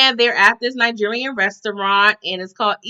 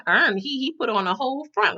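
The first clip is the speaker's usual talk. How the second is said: ordinary talk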